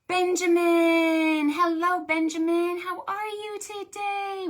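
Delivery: high, loud, delighted hello; light up at seeing Benjamin; excited greeting. Real, not acted.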